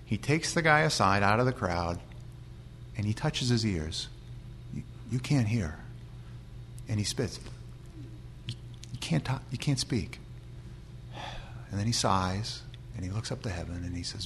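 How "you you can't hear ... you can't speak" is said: soft, almost whispered, speaking close to the deaf man; hushed gentle words. Acted. Jesus gently addressing deaf man.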